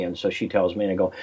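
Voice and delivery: ordinary talk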